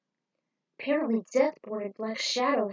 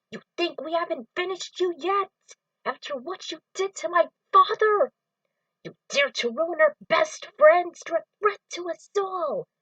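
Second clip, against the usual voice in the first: higher, louder angry voice throughout; furious confrontation; 8 semitones higher, 5 dB louder than usual. Acted. Friend avenging a father, a furious high voice. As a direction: let him have it.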